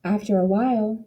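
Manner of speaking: usual speaking voice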